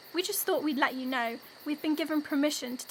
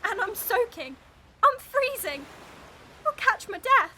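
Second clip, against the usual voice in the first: high, loud, distressed wail; wailing complaint; 8 semitones higher, 8 dB louder than usual. Acted. Rescued twin soaking and freezing, loud wailing. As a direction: wail louder and higher with each complaint.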